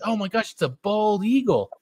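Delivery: high, mock-horrified announcer; aghast commentator. Friend retelling the bald eagle mishap, mock horror. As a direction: imitate a commentator watching disaster unfold. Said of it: "like the whole commentary".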